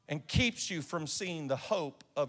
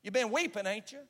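lifted much higher, teasing knowingly; knowing prophet, higher; 6 semitones higher than usual. Retold or imitated. Scene: the prophet tells the preachers he knows they wept, higher. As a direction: pitch it up as if you already know. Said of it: "He goes, he goes".

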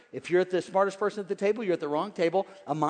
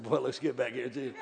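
devil voice; 5 semitones lower, 5 dB quieter than usual. Retold or imitated. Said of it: "And the devil said".

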